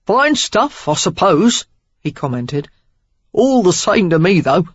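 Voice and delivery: offhand Cockney rogue; Cockney accent. A narrator's reading. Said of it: "Cockney in his accent".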